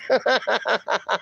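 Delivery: short run of giggles; real chuckle. Real, not acted. Host laughing at "old is gold", chuckling. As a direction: chuckle at a silly rhyme.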